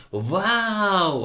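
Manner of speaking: high, drawn-out "wow" cheer; shouted cheer. A narrator's reading. Pupils egging on a scuffle, shouted cheer. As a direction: cheer it out high like an excited crowd. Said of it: "можна було б покричати".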